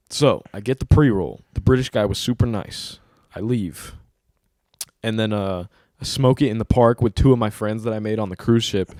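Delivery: normal talking voice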